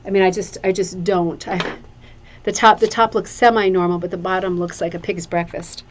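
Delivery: ordinary talk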